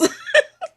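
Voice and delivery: loud, high burst of laughter. Real, not acted. Grandmother laughing about her friend's initials, loud high laugh.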